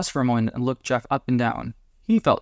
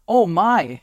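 high, loud exclamation of shock; 8 semitones higher, 6 dB louder than usual. A narrator's reading. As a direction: blurt it out, shock unhidden. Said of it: "he said loudly, not being able to hide the shock".

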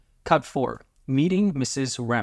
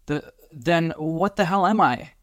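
slow, higher, faltering with fear; fearful quiver; 5 semitones higher, slower than usual. A narrator's reading. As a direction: stammer into a frightened question. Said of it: "heard the quiver and fear in his partner's voice".

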